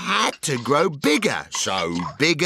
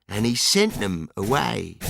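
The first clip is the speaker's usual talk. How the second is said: usual speaking voice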